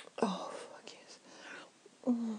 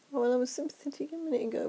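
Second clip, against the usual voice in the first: ordinary talk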